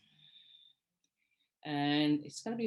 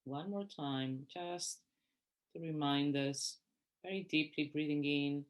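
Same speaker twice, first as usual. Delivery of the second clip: guided meditation; 3 dB quieter than usual. Real, not acted. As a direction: guide them gently through each breath, unhurried. Leads a breathing meditation.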